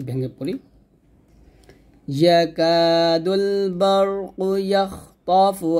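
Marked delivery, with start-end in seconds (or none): 2.1-5.7 slow, drawn-out tajweed chant; Quran recitation. Shown as a demonstration. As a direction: read the whole verse in measured recitation. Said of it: "সম্পূর্ণ আয়াতটা আমি আবার একবার পড়ি". (পুরো আয়াত ধীরে তিলাওয়াত)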